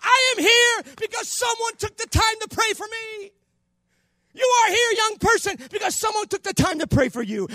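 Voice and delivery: loud, high-pitched sermon delivery; full-force preaching. Real, not acted. Preacher urging the congregation that prayer works, full-force preaching.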